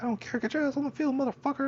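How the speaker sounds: high mock shrill screech at me; Karen yelling. Karen ordering the hurt kid onto the field, high mock scream. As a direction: squeal it like an angry woman ordering him out. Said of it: "she was like".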